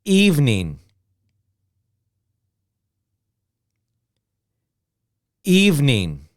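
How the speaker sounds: evening squeezed to two syllables, EVE-NING. Shown as a demonstration. Teacher models the quick American form. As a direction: drop the middle vowel of evening. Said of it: "two sounds".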